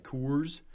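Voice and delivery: "Coors" with a long, drawn O; drawn-out O's. Shown as a demonstration. Describing how Coloradans talk, "Coors" with drawn-out O. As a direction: stretch the vowel in "Coors" out. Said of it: "you guys really draw those O's".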